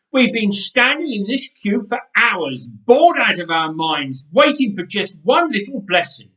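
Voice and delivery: loud, fast, high protest; indignant rant. A narrator's reading. Nathan protesting to the helpers, loud and indignant. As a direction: refuse to budge, full of outrage.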